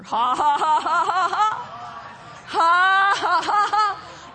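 deliberate laugh rising in pitch; forced hearty ha-ha in rolling bursts; demonstrated laughter. Shown as a demonstration. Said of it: "Can you practice with me?".

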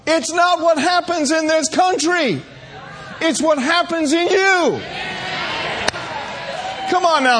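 high rousing sermon shout; preaching shout. Acted. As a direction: ring it out to the congregation. Preacher building to climax.

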